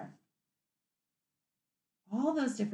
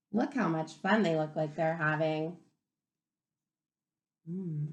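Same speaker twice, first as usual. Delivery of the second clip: usual speaking voice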